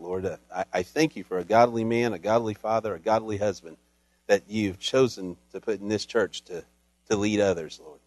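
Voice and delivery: soft, hushed prayer voice. Acted. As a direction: pray low and reverent. Pastor prays over reader.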